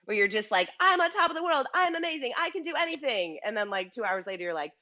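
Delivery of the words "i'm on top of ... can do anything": mock triumphant. Host acting out listeners' highs, mock triumphant and higher. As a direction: crow like you own the world. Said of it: "roller coaster of emotions".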